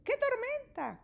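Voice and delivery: very high chirping bird. A narrator's reading. Bird at the cave entrance chirps about the storm. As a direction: squawk it like a little bird. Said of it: "Y Ave dice".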